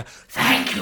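high divine voice. Acted. God voice thanks for the coin.

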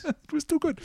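put-on high laughing echo in a vague German or French accent; captain echoes Taryn, laughing high in her accent. High laughing echo in a vague German or French accent.

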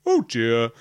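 a lightly voiced monster in a different timbre; just a hint of the beast, mildly worried; Otyug monster voice. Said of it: "the Otyug says".

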